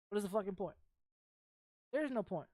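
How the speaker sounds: ordinary talk, nothing marked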